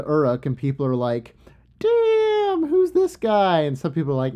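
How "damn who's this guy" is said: drawn-out high-pitched "damn" of amazement; impressed astonishment. Retold or imitated. Townspeople gawking as Enkidu strolls into Uruk, high mock amazement. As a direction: play a gawking onlooker, pitched way up.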